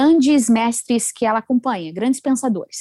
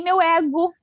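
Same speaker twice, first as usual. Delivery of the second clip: ordinary delivery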